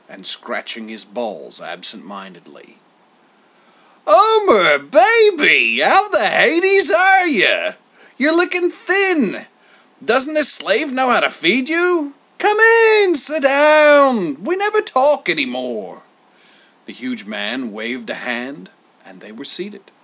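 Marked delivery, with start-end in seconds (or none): 4.1-16.0 high, loud over-the-top glad-handing; big, booming schmoozer greeting; boisterous Zeus, effusive. Acted. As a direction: bellow a showy welcome to an old pal. Zeus greets Homer heartily.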